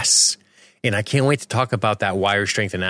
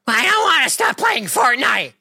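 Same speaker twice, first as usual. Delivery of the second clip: put-on whiny kid voice; 3 dB louder than usual. Mock whining kid.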